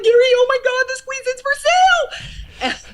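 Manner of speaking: hammed-up, bursting, high-pitched yell; re-enacted ecstatic shriek. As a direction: squeal the big news down the phone. Re-enacts excited call to husband.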